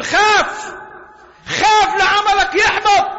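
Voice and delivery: fiery sermon warning; preaching at full force. Acted.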